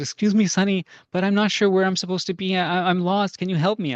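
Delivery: high-pitched imitation of a lost old lady; old woman. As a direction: voice a little old lady asking for help. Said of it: "this old woman knocks on my door".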